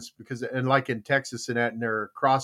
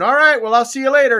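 high, loud cheery goodbye; breezy farewell; 10 semitones higher, 9 dB louder, faster than usual. Retold or imitated. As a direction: slip away all innocent. Fisherman voiced in joke.

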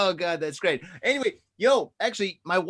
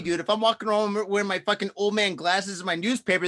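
ordinary delivery

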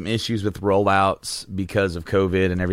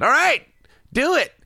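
put-on high, louder childish agreement; eager child; 4 dB louder than usual. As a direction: squeal it like a kid bracing for a deal. Retells child self accepting deal.

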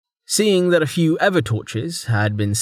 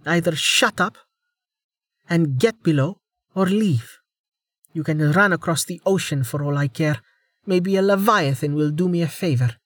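heated ultimatum; 7 semitones higher than usual. Acted.

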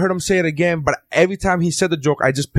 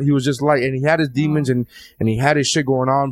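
ordinary talk, nothing marked

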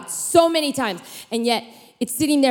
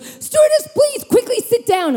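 high, urgent mock announcement; squeaky, pitched-up alarm; panicky pilot; 12 semitones higher than usual. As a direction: pilot losing it over the intercom. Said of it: "the pilot gets on and he goes".

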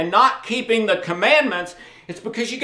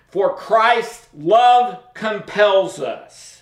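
slow reading; much slower than usual. Shown as a demonstration. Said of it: "reading key verse slowly".

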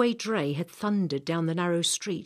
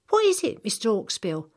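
put-on higher, eager girl's question; a curious young girl asks, lifted and bright; 6 semitones higher, 6 dB louder than usual. Samantha asks.

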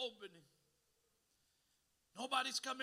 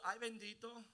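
mock pity; 3 semitones lower, 5 dB quieter than usual. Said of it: "God never goes".

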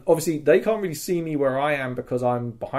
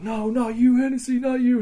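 anguished sergeant; 11 semitones higher than usual. Retold or imitated. Sergeant finds him wounded.

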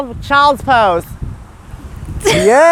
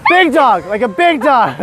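usual speaking voice

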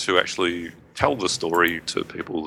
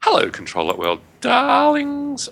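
high-pitched greeting; 4 dB louder, slower than usual. Acted. Reading Juno's greeting aloud.

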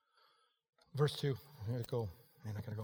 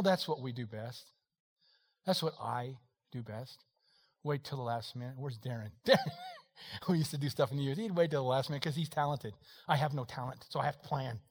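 ordinary talk

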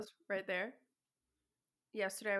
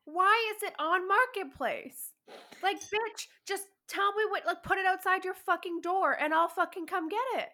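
higher and louder, fed-up ranting; exasperated rant; 9 semitones higher, 6 dB louder than usual. Real, not acted. Friend rants to her podcast cohost about a flaky seller, exasperated and loud. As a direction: vent your frustration at the flaky seller.

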